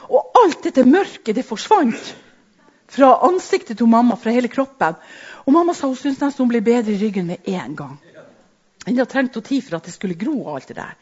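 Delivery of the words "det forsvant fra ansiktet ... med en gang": ordinary talk, nothing marked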